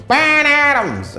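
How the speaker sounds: put-on loud, high, slow drunken howl of the name; drunk yell. Drunk woman in the crowd yelling at the comic. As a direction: holler it like a drunk heckler. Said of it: "She goes".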